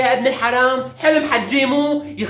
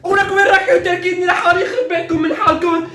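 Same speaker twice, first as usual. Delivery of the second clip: 1 pleading shout; 7 semitones higher, 3 dB louder than usual. Acted. Pleading not to be left.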